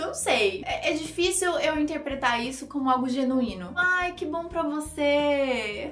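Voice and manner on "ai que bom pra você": drawn-out mock-happy congratulation; sarcastic. Teacher showing how "good for you" hardly sounds genuine, sarcastic. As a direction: stretch it out slowly, insincerely pleased. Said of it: "a gente tá sendo sarcástico".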